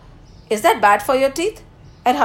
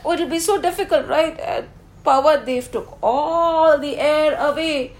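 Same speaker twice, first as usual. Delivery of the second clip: usual speaking voice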